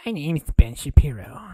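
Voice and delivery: loud, odd mock introduction; loud Ben Shapiro bit. Streamer loudly introduces himself as Ben Shapiro. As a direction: announce yourself in a silly voice.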